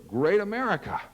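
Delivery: high-pitched ad tagline delivery; announcing to camera. Acted. Dad turns to the camera for the slogan, high proclamation. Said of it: "turns to the camera and goes".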